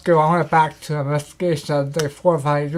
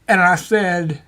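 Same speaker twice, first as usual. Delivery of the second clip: normal talking voice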